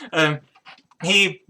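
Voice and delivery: ordinary talk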